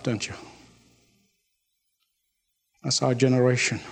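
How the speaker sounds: usual speaking voice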